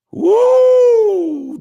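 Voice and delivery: high, loud "woo"; long excited whoop. Real, not acted. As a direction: let out a big, high whoop. Whoops after reading comment.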